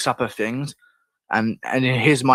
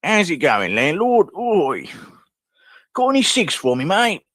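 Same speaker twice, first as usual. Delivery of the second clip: high, swooping Cockney pub patter; hammed-up high Cockney scrounger; 6 semitones higher, slower than usual. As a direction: hail the landlord in exaggerated Cockney. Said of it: "is she just a Cockney voice?".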